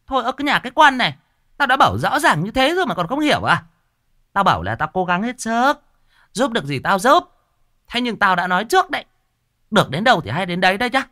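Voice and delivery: high, loud, fast scolding; shaman snapping. Acted. Shaman scolding the dazed old woman, loud and fast. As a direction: snap at her for not understanding. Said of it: "cô đồng sinh quát".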